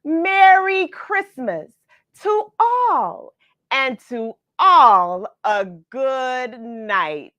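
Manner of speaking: high, loud, slow jolly Santa call; Santa's exclaim. Acted. Santa calling goodbye as his sleigh flies off, high and loud. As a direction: boom it out like Santa flying off. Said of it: "I heard him exclaim".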